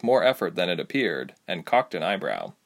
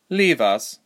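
plain reading voice

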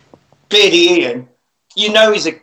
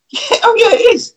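laughing. Real, not acted. Laughing at RoboCop remark.

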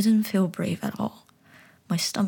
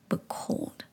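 plain reading voice

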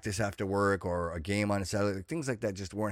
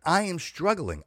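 usual speaking voice

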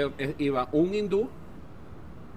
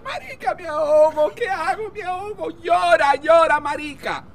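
very high frantic voice, growing louder; hammed-up drowning panic; 12 semitones higher, 5 dB louder than usual. Drowning woman calls to her friend, hammed-up panic. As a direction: wail it as if sinking.

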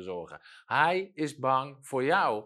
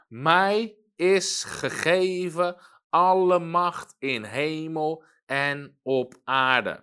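slow and louder, every word weighted; solemn scripture reading; 3 semitones higher, 6 dB louder, much slower than usual. Acted. Preacher reading Jesus' words to the church, slow and solemn. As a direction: read it out like a proclamation.

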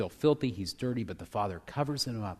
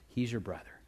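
tender whisper. Acted. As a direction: breathe the father's words gently. Whispered final plea.

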